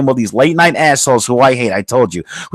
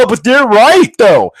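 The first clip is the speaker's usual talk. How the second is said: mock objection; 6 semitones higher, 4 dB louder than usual. Mocking liberals' objection.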